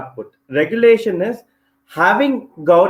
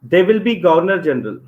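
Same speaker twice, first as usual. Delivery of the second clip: ordinary delivery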